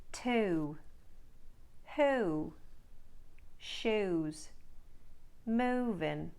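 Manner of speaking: Australian vowels, said slowly one by one; Australian accent demo. Shown as a demonstration. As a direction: model each word in an Australian accent. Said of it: "Let's try some words and phrases for practice".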